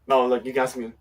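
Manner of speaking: ordinary talk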